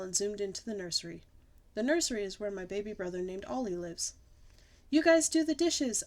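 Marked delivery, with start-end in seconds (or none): 4.9-6.0 high, louder call down the hall; mock holler. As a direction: call back to parents. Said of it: "I hollered".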